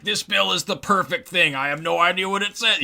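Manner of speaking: high, mocking voice of a naive fan; mock someone who loves the bill blindly; clueless bill supporter. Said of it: "I'm not like".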